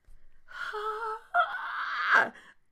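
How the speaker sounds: high, loud, crying wail of disbelief; exasperated wail. Real, not acted. Gamer wailing as her Sim has twins. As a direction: let out a long, shrill groaning wail.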